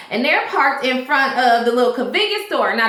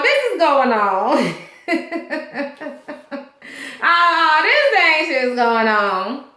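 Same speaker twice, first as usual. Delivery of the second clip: breaking into laughter while talking; laughing; 2 semitones higher, slower than usual. Real, not acted. Host cracks up recapping the mess, laughing. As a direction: laugh through it, drawing out the last words.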